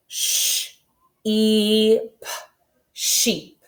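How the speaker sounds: "Sh, e, p" split into separate sounds, then "sheep" blended; sounding out. Shown as a demonstration. Teacher sounding out sheep for the class.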